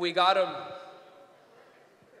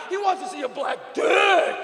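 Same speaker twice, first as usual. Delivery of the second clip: loud, slow, raised-pitch realisation; incredulous; 6 semitones higher, 5 dB louder, slower than usual. Acted. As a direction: draw out the punchline in comic horror. Inner realisation, built to punchline.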